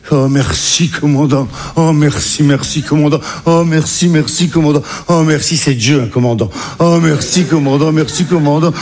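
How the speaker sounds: hammed-up repeated thanks; overwhelmed gratitude. Son thanks the commander for the seat, gushing relief. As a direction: pour out thanks as if to a saviour.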